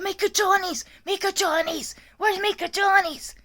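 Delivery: high-pitched mock yell in Spanish; imitated insistent yelling. Aide imitates the patient who won't stop, high insistent mock yell. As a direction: imitate her yelling, pitched right up and drawn out at the end. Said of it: "She just keeps yelling".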